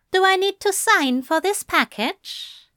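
high, smug angel voice; teasing, high-voiced come-on; smirking. A narrator's reading. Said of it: "The angel smirked".

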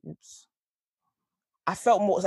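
usual speaking voice

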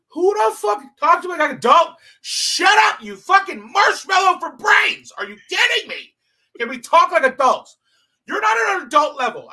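loud, angry retold outburst, building; furious shout. Comic retelling his blowup at a partygoer, furious shouting. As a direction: yell it at her like she insulted you.

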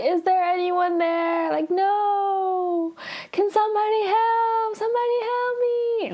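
high, tearful cries for help; crying for help. Retold or imitated. Aro alone in the forest, crying out for help. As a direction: mimic a sobbing girl lost and calling out. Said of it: "she's, like, crying".

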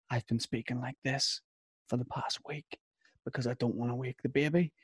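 put-on hushed near-whisper so the baby sleeps. Shown as a demonstration. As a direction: drop to a whisper. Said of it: "like, a whispered voice".

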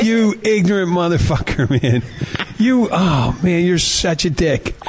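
mock exasperated name-calling; mock indignant insult. Acts out people insulting him.

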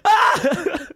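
short high-pitched comic scream; sudden high yelp of mock horror. He sees her leg drop off, mock scream.

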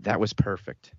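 a quiet narrated murmur; hushed. A narrator's reading. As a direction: say it low-key, almost whispering. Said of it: "I smiled and whispered".

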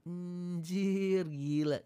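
quick high blurted exclamation, imitating young people; slangy youth exclamation. Young vlogger swearing casually, imitated high. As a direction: toss it off like a careless kid. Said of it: "Kalau anak muda semua kadang-kadang keluar".

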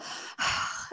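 long, voiceless sigh; deep stressed sigh. Shown as a demonstration. Parent showing own stressed habit, deep sigh. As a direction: let out a heavy, stressed sigh. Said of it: "like, deep sigh that I do".

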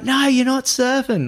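high, drawn-out mock refusal; retold firm refusal. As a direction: act out mates refusing, slow and high. Mates refusing his yelled demand.

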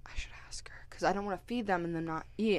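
unvoiced whisper of an inner worry; hushed aside about feeding the dogs; whispered thought. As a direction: whisper it to yourself.

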